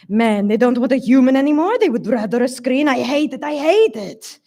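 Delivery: put-on high, heated Hungarian-accented rant; thick Hungarian accent. A narrator's reading. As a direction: rail against it in heavy Hungarian accent. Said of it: "Hungarian accent".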